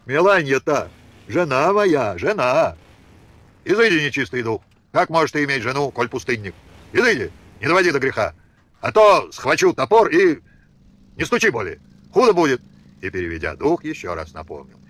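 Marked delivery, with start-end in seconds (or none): 0.1-2.7 desperate plea. Acted. Son pleads with father. 3.7-12.6 louder, furious father roaring at the door; angry shout. Acted. Father driving his son from the house, furious shout.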